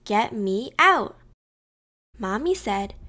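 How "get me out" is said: slow, slightly raised storybook shout; understated cry to be let out; mild narrated shout. A narrator's reading. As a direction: draw it out as a held-back shout. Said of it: "I shouted".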